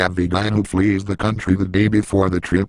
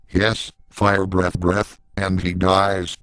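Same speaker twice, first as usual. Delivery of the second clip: plain reading voice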